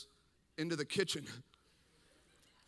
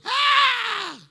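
very loud, high screamed "ah"; imitated corpse shout; 17 dB louder than usual. Dead dad jolts at his son, high screamed cry. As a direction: blast out a sudden high cry. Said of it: "and just goes".

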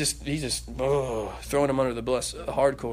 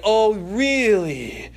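drawn-out sarcastic oh really; slow, loud, incredulous; sarcastic father; 4 semitones higher, 7 dB louder, much slower than usual. Acted. Father answering his ungrateful son, sarcastic.

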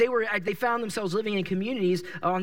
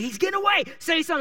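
urgent pleading; 8 semitones higher, faster than usual. Retold or imitated. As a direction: push your friend before he's gone. Urging friend as Jesus leaves.

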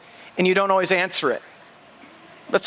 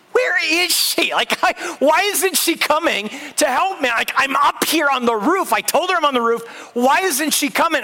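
higher, exasperated venting; 6 semitones higher than usual. Retold or imitated. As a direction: replay the frantic frustration of being ignored. Stuck on roof, nobody answers knocking.

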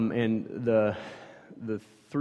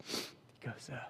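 ordinary talk, nothing marked

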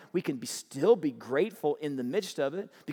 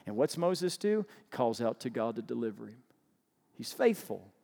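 usual speaking voice

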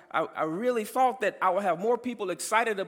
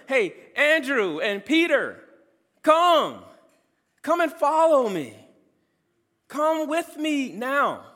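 high, loud summons, words drawn out; calling disciples; 5 semitones higher, 5 dB louder, slower than usual. Retold or imitated. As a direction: call out commandingly to followers. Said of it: "he is saying to them".

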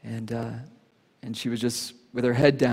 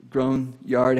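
ordinary talk

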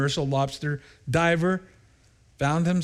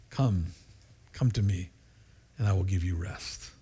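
soft, low, gentle invitation; tender call; 5 semitones lower, 5 dB quieter than usual. Acted. God softly calling the sinner, low and tender.

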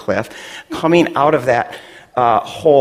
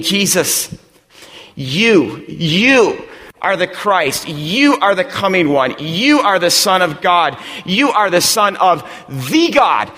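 preaching; 6 semitones higher than usual. Acted. Pastor driving the point home to his congregation, preaching. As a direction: preach it to the room with growing force.

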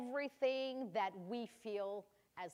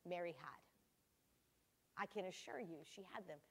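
hushed; 5 semitones lower, 12 dB quieter than usual. Real, not acted. Sermon point, hushed for emphasis.

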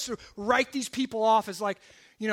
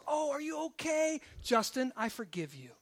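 soft, tender acted concern; sweet wife; 3 semitones higher, 4 dB quieter than usual. Wife comforting her husband after he punches someone, sweetly. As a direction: soothe gently, then forgive softly. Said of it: "She's really sweet".

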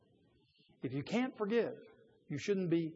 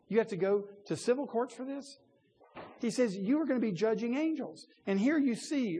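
higher, pressing indignation; preacher's indignant challenge; 6 semitones higher, 3 dB louder than usual. Real, not acted. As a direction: challenge the congregation, incredulous. Rhetorical indignation in sermon.

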